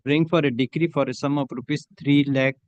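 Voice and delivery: steady, measured dictation of a judgment for the record; dictating a court order. Acted. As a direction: read each phrase out clearly for the stenographer to type.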